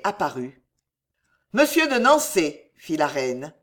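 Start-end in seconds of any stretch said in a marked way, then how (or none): 1.5-2.7 high, loud summons; raised, commanding call. A narrator's reading. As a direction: call for the captain imperiously. Queen calls for her captain.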